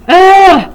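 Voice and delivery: a high, loud "ugh" of disgust; loud groan of disgust. Acted. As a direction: groan like a bored crowd. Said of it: "The whole school groaned".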